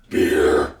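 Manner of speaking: deep muffled monster growl through a mask; Krampus voice. Retold or imitated. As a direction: growl it low like a beast behind a mask. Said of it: "is that your Krampus voice?".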